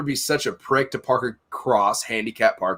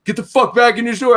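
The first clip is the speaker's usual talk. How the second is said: high, loud mimicked order; angry yelling; 7 semitones higher, 5 dB louder than usual. Neighbor yelling at the store manager, retold angry outburst. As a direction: imitate an irate man ordering someone off.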